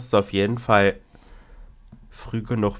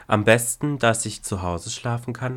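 ordinary talk, nothing marked

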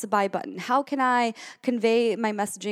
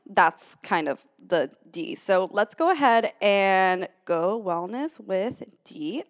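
ordinary talk, nothing marked

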